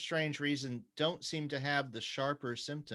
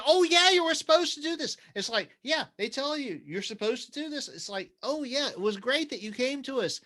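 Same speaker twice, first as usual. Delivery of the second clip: high-pitched mock reassurance; high, sing-song mockery; mocking quote; 8 dB louder than usual. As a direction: parrot them in a loud, high voice. Mocking what hospital people say.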